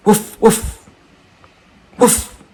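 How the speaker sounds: playful fake woofs; imitated bark. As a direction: bark at the dog to rile it up. Barking at the dog to tease.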